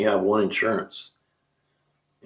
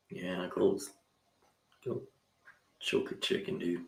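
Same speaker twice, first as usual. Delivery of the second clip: muttered brush-off; 14 dB quieter than usual. Real, not acted. Grumbling at the cops.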